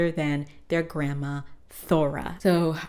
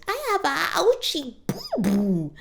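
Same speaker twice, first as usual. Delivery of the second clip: put-on much higher, whiny little-kid baby voice; baby talk; 12 semitones higher, 3 dB louder than usual. [name] whimpering to his friends in baby talk. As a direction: pout it out like a hurt toddler. [name] whining after being tackled.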